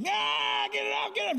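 high panicked scream; hammed-up high shriek, get it out. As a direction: shriek like dust hit your face. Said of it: "he just starts screaming".

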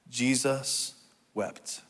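slow, soft, a pause between the words; slow group recitation. Real, not acted. Preacher leading congregation in a two-word verse, slow and soft. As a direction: say it slowly for others to join. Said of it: "say it with me".

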